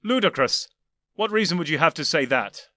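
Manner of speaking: defensive and indignant. Acted. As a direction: throw Ludicrous back, wounded. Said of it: "indignant and defensive".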